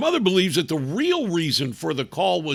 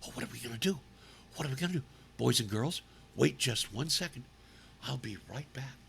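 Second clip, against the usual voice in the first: much lower, very soft fretting; mock fretful teacher; 8 semitones lower, 14 dB quieter, much faster than usual. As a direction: play the teacher whispering in panic. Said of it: "who then looks and says".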